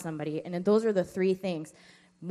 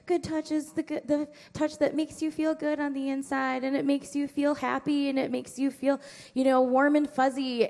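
put-on higher, sweet voice for little kids; sing-song teacher voice; 6 semitones higher than usual. Presenter explaining good touch to kindergartners, sing-song.

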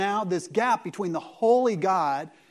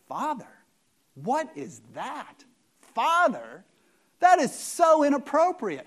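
incredulous, higher and louder; mock outrage; 6 semitones higher, 4 dB louder than usual. Preacher feigning shock at calling God Father, mock outrage. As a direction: play the scandalised listener, pitch up.

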